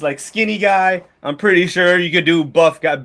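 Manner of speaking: ordinary delivery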